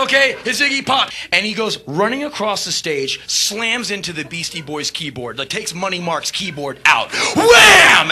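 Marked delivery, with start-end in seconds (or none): 0.0-1.1 much higher, louder stage shout; hyped announcement. Retold or imitated. Singer introducing [name] to five thousand, loud shout. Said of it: "And I go". 7.3-8.1 very high, loud crash yell; crash shout. Retold or imitated. Describing [name] smashing the keyboard, loud yell.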